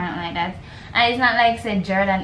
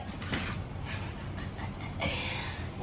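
breathy unvoiced laugh; 15 dB quieter than usual. Real, not acted. Laughs at own confusion.

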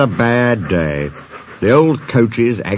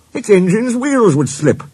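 high-pitched protest; objecting; 12 semitones higher, 4 dB louder than usual. A narrator's reading. Engine argues mountain wheels would slip, objecting. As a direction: argue back in a raised pitch.

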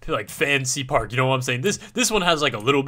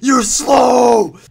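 loud, high-pitched mock coach shout; exaggerated angry bellow; imitated coach yelling; 11 semitones higher, 11 dB louder than usual. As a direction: yell it like an irate coach berating kids. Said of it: "literally yelling at these kids like".

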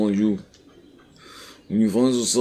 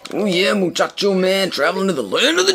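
wrestler impression; 11 semitones higher, 3 dB louder than usual. Imitating Macho Man catchphrase.

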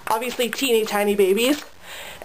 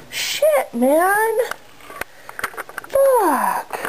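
upset curse; 10 semitones higher, much slower than usual. Real, not acted. After hurting the cat.

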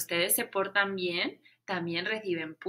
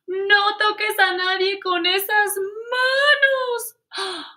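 teacher's shout; 9 semitones higher, 8 dB louder, slower than usual. Acted. Teacher yells at painted hands.

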